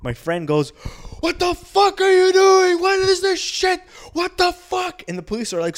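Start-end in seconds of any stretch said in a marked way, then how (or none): 1.2-5.0 put-on high, very loud, drawn-out drunken outrage; mock outraged yelling. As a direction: act out a drunk friend hollering at police. Drunk friend yells at police.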